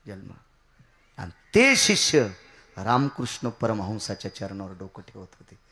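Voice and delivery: normal talking voice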